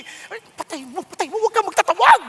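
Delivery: hammed-up panic telling his wife not to call anyone; mock panic. Pastor telling his wife to switch the TV off, building mock panic yell. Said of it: "Sabi niya".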